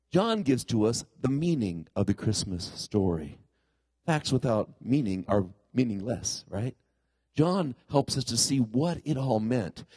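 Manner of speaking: usual speaking voice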